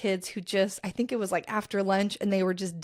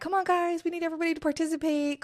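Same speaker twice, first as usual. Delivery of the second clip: upbeat coaxing; 8 semitones higher than usual. Retold or imitated. Coaxing kids to join in.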